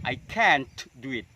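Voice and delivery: slow model sentence, T in can't popped; released final t. Shown as a demonstration. Teacher repeating the example for learners, slowly, T popped in can't. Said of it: "Itu contoh yang dilutupkan".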